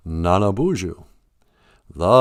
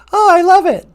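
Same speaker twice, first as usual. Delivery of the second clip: very high cooing voice; high pet voice; 5 dB louder than usual. Acted. A squeaky cooing voice praising the host's intro. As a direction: coo it like baby talk.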